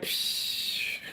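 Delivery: unvoiced psh spray noise; spraying hiss. Vlogger miming spraying onion water in her hair. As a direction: puff out a spray bottle sound. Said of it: "and be like".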